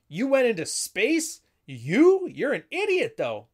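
high, loud, incredulous outburst; Grimes. Acted. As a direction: act out a scornful, disbelieving rival. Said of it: "Grimes is trying to manipulate Homer".

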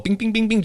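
high flat pinball bings; ping it out like a pinball machine. Shown as a demonstration. Pinball sound imitation.

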